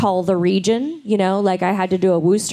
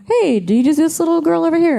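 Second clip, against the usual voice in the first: studio request; 9 semitones higher, 4 dB louder than usual. Retold or imitated.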